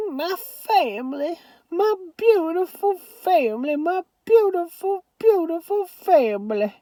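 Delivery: high tearful Southern grandma; weeping grandma. A narrator's reading. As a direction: repeat it through happy tears. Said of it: "all the weeping".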